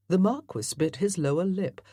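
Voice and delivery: ordinary delivery, nothing marked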